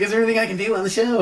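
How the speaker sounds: imitating an eager offer, fast and high; Sammy voice. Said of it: "my Sammy voice".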